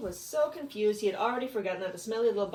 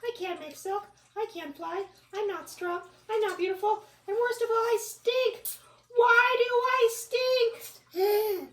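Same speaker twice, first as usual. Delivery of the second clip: high whiny bug; 8 dB louder than usual. Acted. Bug laments, building.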